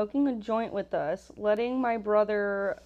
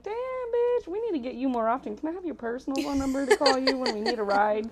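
starts much higher, then fast and gushing; drunk praise; 4 semitones higher than usual. Retold or imitated. Drunk passenger gushes to the Uber driver, high and fast. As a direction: gush at the cool driver like a drunk fan.

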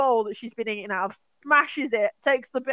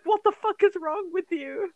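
mock outraged yell; 10 semitones higher, 4 dB louder than usual. Group voiced in outrage.

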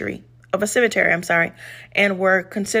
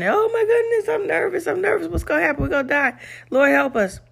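much higher, breathless nervous cry; panicked disciples; 9 semitones higher, faster than usual. Retold or imitated. Disciples panicking to Jesus in the storm, high frightened voice. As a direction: play a frightened disciple sure the boat will sink.

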